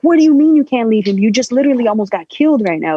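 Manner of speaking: retold outburst of disbelief, pitch jumping up; incredulous protest. Child arguing with mom who won't leave him, incredulous.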